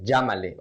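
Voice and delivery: llámale with LL as zh; J sound. Shown as a demonstration. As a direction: say llámale "zhah-ma-leh". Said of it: "with the J pronunciation".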